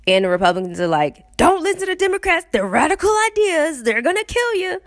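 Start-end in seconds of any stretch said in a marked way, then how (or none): 1.4-4.8 high-pitched hammed-up scaremongering; mock alarmism. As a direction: warn them in mock panic, high and urgent. Mimics Republicans' alarmist warnings.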